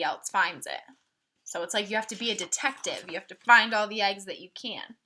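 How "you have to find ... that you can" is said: normal talking voice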